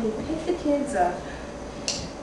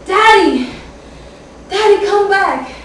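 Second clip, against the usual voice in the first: high, loud cries for daddy; child screaming; 8 semitones higher, 9 dB louder, slower than usual. Acted. Little girl running to her father, screaming for him. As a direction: call out desperately after him.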